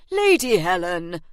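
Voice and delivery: loud, scandalised interruption; agent's sharp exclamation of embarrassment; mortified. A narrator's reading. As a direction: cut her off, appalled. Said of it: "cast Harry a mortified glance".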